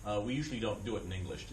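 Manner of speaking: usual speaking voice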